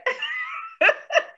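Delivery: high, sudden burst of laughter; big laugh. Real, not acted. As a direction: let out a real high laugh. Laughing at the show.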